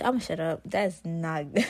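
ordinary talk, nothing marked

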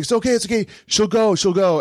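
imitating a nervously compliant thief; anxious placating. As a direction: placate an angry captor, voice lifted. Cornered thief placates the store owner.

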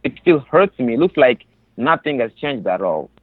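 ordinary delivery

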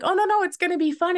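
put-on high, sing-song coaxing to a toddler; bright mock-cheerful reassurance. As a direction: sell it as fun to a scared little boy. Coaxing son out of diaper.

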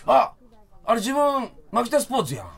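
light Kansai lady voice; imitating a Kansai fan, lightly; Kansai accent. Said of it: "みたいな女性の方よ".

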